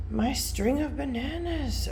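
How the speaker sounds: unvoiced dismayed whisper. Real, not acted. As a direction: whisper in mock despair. Whispered dismay over plant.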